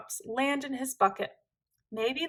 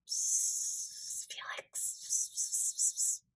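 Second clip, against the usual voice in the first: unvoiced, hushed psst; sneaky classroom whisper; 12 dB quieter, slower than usual. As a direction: hiss his name secretly across the classroom.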